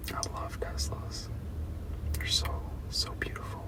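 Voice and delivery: I love Teslas whispered, no voice; hushed longing whisper. Real, not acted. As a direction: breathe it out in a dreamy whisper. Whispered repeat to chat.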